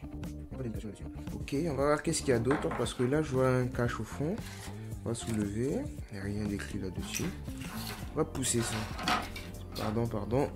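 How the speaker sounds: ordinary talk, nothing marked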